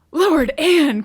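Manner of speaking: loud, high exasperated cry; Lucy crying out loud and high at her sister. Acted. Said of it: "cried her sister".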